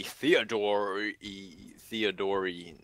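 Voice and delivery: stretched slow mock pronunciation of Theodori; drawn-out name. Joking about the player's name, slowed right down. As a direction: drag the name out for a joke.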